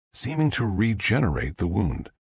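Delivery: ordinary delivery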